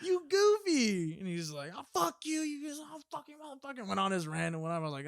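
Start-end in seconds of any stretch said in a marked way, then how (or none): 0.0-1.2 high, loud, very drawn-out mock insult; retold exasperated insult. Storyteller to homeless man, mock exasperated. 1.7-3.9 high-pitched mock rant, tailing off softer; imitated cursing rant. Homeless man ranting at the food giver, mock angry.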